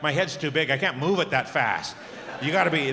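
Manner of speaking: ordinary talk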